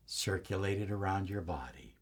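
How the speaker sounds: fully whispered meditation cue; whispered guidance. Shown as a demonstration. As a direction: let it drop to a breath. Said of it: "And as you breathe in".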